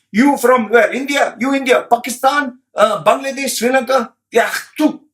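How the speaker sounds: mock high-pitched disdain, firing short questions; contemptuous questioning. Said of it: "that's how they treat you".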